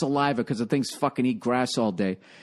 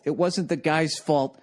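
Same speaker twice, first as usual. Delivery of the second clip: ordinary talk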